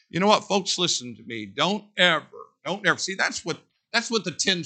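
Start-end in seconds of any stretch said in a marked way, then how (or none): none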